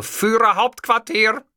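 high, slow announcement; calling out. A narrator's reading. Man switching his phone greeting, calling out.

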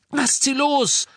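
high, loud desperate yell; roaring. A narrator's reading. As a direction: roar it at the captors. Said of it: "brüllte Ron".

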